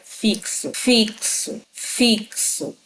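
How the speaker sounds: Fixo said slowly as fik-so, sounding the ks; careful pronunciation. Shown as a demonstration. Teacher leading class to repeat Fixo, pronounced carefully. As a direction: say Fixo clearly with ks in the middle. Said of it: "Eu quero que você pronuncie agora".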